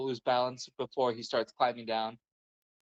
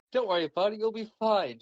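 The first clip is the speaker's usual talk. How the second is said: higher-pitched, breezy pep talk; falsely comforting, pitched up; mock reassurance; 8 semitones higher, 4 dB louder than usual. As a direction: reassure your buddy brightly before shoving him. Voices fake reassurance before shoving friend.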